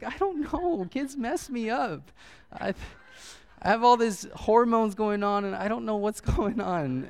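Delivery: hammed-up overwhelmed new dad; mock weepy. Explaining his hormones to his wife, mock weepy whine.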